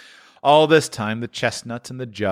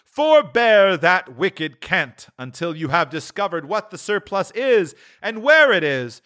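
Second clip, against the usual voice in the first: higher, stern spirit voice; rebuking ghost; 10 semitones higher than usual. A narrator's reading. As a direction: scold Scrooge from above. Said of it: "before the ghost's rebuke".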